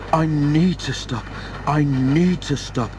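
lightly acted tiredness, slowing on the repeat; a touch of worn-out puffing; weary panting. A narrator's reading. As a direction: plead a little, as if running out of steam. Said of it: "he panted wearily".

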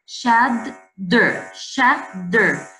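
"Shadder" broken in two, shad, then der; split into syllables. Shown as a demonstration. Teacher shows the two syllables, "shadder" split at the double D. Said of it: "two syllables".

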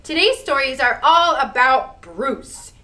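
plain reading voice

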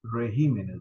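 regímenes, stressed on GÍ. Shown as a demonstration. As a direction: say regímenes with the accent on the second syllable. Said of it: "arrancar con esta palabra". (énfasis en regímenes)

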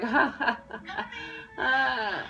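high, spaced-out mock laughter; forced fake laugh. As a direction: fake a laugh along with them. Acts out her forced laugh.

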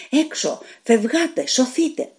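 high urgent shouts to flee; shouting. A narrator's reading. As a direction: call them out to save themselves. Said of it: "τους φώναζε".

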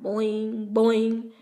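plain reading voice

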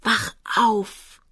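desperate wail to wake someone; crying out through tears; tearful pleading. A narrator's reading. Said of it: "flehte er weinend".

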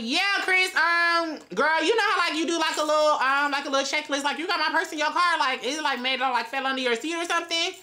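playing dumb and sweet on the phone; sweet innocent act. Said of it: "I'm like".

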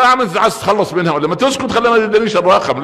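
hammed-up amused mockery; teasing mockery. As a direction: poke fun at the eager husband. Mocking husband eager to separate.

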